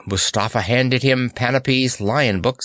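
plain reading voice

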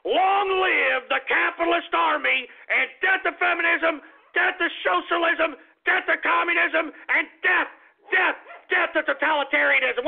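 high-pitched, ringing declamation; rallying slogans. Real, not acted. Radio host signing off with slogans, rallying cry. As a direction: proclaim each slogan like a rally leader.

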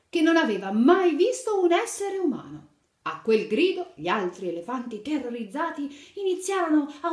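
put-on lively read-aloud to little children; animated picture-book narration; storytelling. A narrator's reading. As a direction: tell the fairy tale brightly to kids.

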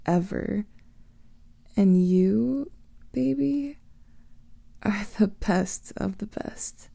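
usual speaking voice

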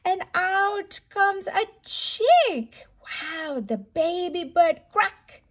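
usual speaking voice

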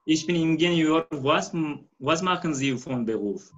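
normal talking voice

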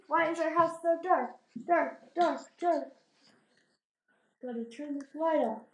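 ordinary delivery

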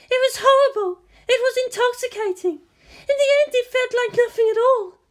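put-on high-pitched, haunted young woman; high woman's voice. A narrator's reading. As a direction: voice her high and distant.

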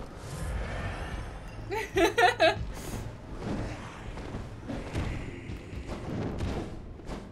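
long breathless wheezing laugh. Real, not acted. Gamer running from boss, wheezing laugh. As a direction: collapse into laughter.

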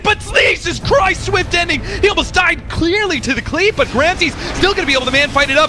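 high, shouted esports call easing as it goes; excited play-by-play. Real, not acted. Caster reacts to the near death, shouted play-by-play. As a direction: peak team-fight commentary, pitch way up.

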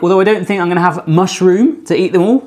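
normal talking voice

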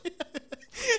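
high-pitched burst of laughter. Real, not acted. Ri da piada no jogo. (risada aguda)

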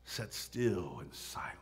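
slow, low, near-whisper; hushed. Real, not acted. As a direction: let it sink into stillness. Hushed telling of girl falling silent.

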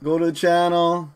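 ordinary talk, nothing marked

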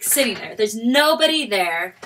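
normal talking voice

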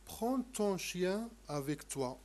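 slow, clear French model sentence; slow model reading. Shown as a demonstration. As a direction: read each word out slowly for the class. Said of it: "قل هذا الحوار مع أصدقائك". (قراءة بطيئة وواضحة)

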